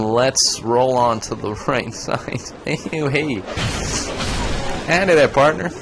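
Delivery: normal talking voice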